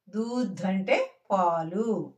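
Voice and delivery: ordinary delivery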